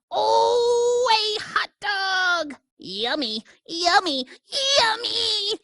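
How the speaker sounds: high, thrilled pigeon voice gushing over food; excited pigeon. Acted. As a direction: squeal like a greedy bird finding a treat.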